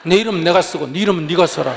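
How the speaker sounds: loud, blunt refusal; loud curt refusal. Retold or imitated. Monk bluntly refusing a fan, loud and curt. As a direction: snap back that they can write it themselves.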